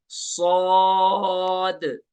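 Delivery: "सौद" held long, full and heavy, with a whistle; heavy long whistled sawd. Shown as a demonstration. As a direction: stretch "सौद" three counts, heavy and whistling. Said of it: "सौद को भी हम तीन अलिफ खींचेंगे". ("सौद" को पुर और सीटी के साथ तीन अलिफ खींचें)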